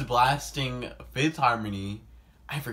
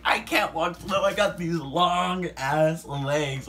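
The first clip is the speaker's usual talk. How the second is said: indignant protest; 6 semitones higher, 4 dB louder, slower than usual. Retold or imitated. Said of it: "And I'm like".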